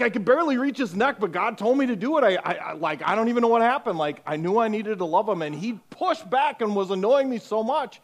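high, loud, fast breathless telling; high loud excited rush; excited girl. Retold or imitated. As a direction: gush like a thrilled teen. Girl voiced, excited retelling.

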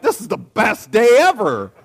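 loud, high burst of joy; elated. Acted. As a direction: crow it like you won a prize. Acts his joy at news.

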